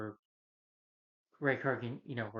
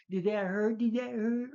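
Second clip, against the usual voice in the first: high, loud mocking taunt; mocking taunt, much higher and louder; taunting villain; 10 semitones higher, 7 dB louder, slower than usual. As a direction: needle him like a gloating villain. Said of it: "Corey's like".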